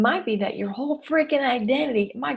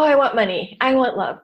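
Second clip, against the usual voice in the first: ordinary talk, nothing marked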